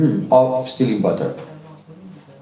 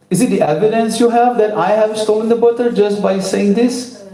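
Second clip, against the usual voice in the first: put-on cheeky child; 8 semitones higher than usual. A narrator's reading. Child arguing.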